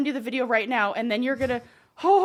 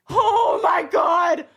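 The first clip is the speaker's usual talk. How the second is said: dread; 5 semitones higher, 5 dB louder, much slower than usual. Real, not acted. Watching the mouse in dread.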